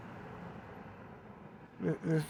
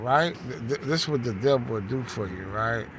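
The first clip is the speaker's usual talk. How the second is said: ordinary delivery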